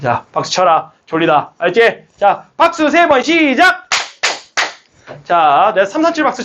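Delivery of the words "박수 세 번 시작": high, drawn-out teacher's count-in; calling a cue. Real, not acted. 졸린 학생들에게 박수 구령. (길게 늘여 외치는 구령)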